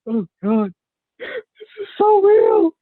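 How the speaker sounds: rising to a high loud squeak on so real; overwhelmed. Retold or imitated. Retelling crying at a show, high squeak. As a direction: voice cracks up high with emotion. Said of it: "I'm just like".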